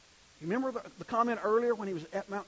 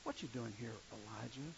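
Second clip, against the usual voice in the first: God asks Elijah quietly, much softer; soft, gentle tone; 8 semitones lower, 13 dB quieter than usual. Shown as a demonstration. God gently questioning the weary prophet, soft and hushed. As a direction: ask it softly, like a still small voice. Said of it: "about the tone".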